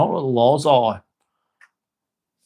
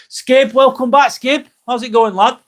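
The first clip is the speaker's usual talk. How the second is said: higher, louder, slowed welcome, rising on the last name; excited greeting; 10 semitones higher, 4 dB louder than usual. Real, not acted. Streamer welcoming a returning viewer, excitedly.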